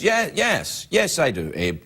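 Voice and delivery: normal talking voice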